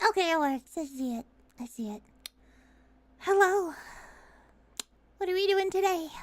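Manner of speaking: ordinary talk, nothing marked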